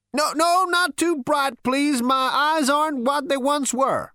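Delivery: put-on high, loud old-man fussing; querulous old great-grandfather. Old ancestor squints at the lights, high and querulous. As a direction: fuss in a high-pitched elderly voice.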